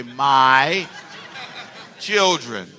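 put-on "My", pause, "children", each drawn out; slow and deliberate. Shown as a demonstration. Pastor leading congregation to own up, slow "My children". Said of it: "Can you say it slow?".

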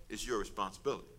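low, soft and understated; understated quote. Retold or imitated. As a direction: say it quietly, letting the voice drop. Quotes Jesus, then repeats for emphasis.

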